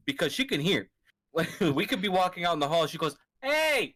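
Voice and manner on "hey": high sharp hey; old lady yell. Retold or imitated. Imitating the resident calling out, high yell.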